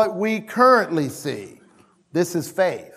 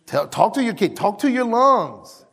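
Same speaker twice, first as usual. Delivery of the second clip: ordinary delivery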